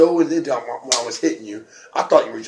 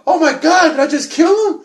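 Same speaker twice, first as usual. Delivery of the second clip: higher and louder, a hammed-up alarmed cry; mock panic; 6 dB louder than usual. Wrestler recalling the knockout to his opponent, mock-panicked cry. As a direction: play the panic of thinking you killed him.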